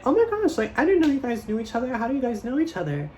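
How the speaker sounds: put-on bubbly girlfriend voice. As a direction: gush with surprise like a sweet party host. [name] voiced, surprised and bubbly.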